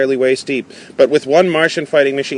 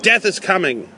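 restrained dramatic shout; understated shout; 7 semitones higher than usual. Acted. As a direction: warn them urgently, pitched up without full volume. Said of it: "I shouted".

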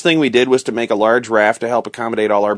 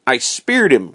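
usual speaking voice

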